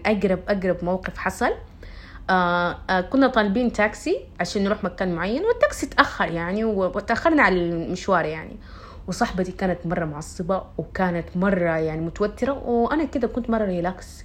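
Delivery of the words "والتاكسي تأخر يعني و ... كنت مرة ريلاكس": ordinary delivery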